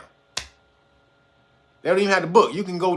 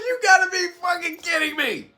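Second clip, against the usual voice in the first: laughing quote; 9 semitones higher than usual. Retold or imitated. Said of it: "He said".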